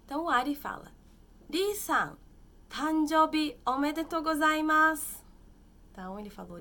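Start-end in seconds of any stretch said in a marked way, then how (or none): 1.5-5.2 a louder, higher Ari greeting; teacher acts out the birthday wish; voicing Ari. Teacher voicing Ari at the birthday, lightly raised and loud.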